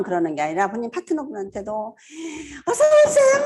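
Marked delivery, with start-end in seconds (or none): 2.7-3.4 high bright welcome; cheerful welcome. Retold or imitated. 파트너를 맞으며 높고 밝게 부르는 환영. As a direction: sing out the welcome. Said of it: "이렇게".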